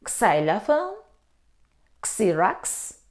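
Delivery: xylophone and Xerox begun with "ks"; mispronounced initial X. Shown as a demonstration. Teacher testing learners with wrong readings, "ks" starting xylophone, Xerox.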